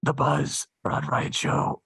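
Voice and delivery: slow, drawn-out station imager through cupped hands; station ID with a muffled filter sound; filtered radio sweeper. Shown as a demonstration. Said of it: "that little sound of the".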